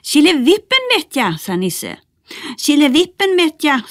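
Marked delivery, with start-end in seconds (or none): 0.0-1.4 high Nisse call. Acted. Nisse calls the magic word. 2.6-3.9 high Bertil call. Acted. Bertil repeats the misheard word.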